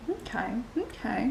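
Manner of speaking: a whispered aside to herself; whispered okay. Real, not acted. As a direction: breathe it out in a whisper. Aside before the backstory.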